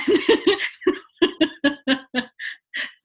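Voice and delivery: loud, high laugh that falls away; real burst of laughter. Real, not acted. Remembering the steep hill with Francesco: a loud laugh. Laughing at her own story.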